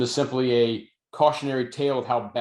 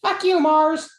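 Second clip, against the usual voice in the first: put-on very high, louder little-kid voice parroting the line; a small child proudly echoing a movie curse; little girl imitating; 6 dB louder, slower than usual. Amelia on the couch copying Matt Damon, squeaky kid voice. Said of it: "Amelia goes".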